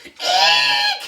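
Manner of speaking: a loud squeal; mild scream. Acted. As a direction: squeal at the bug. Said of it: "she screamed".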